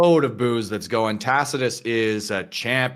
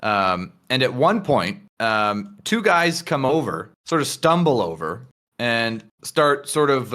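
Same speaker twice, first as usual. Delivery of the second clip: ordinary talk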